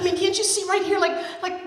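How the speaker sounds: put-on high, loud, pleading child; high excited girl pleading; urgent girl voice. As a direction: beg her dad to look.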